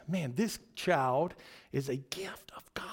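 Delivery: hushed whisper of proud parents. Retold or imitated. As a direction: breathe it like a secret blessing. Parents voiced in the retelling.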